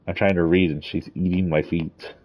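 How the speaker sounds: ordinary delivery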